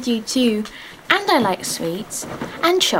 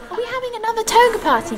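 put-on high childish voice; 11 semitones higher, much faster than usual. Child teasing teacher in class.

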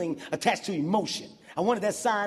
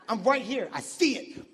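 ordinary talk, nothing marked

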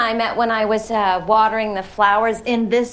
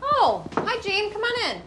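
high sing-song greeting; 12 semitones higher, 6 dB quieter than usual. Acted. Greeting visitor at the door.

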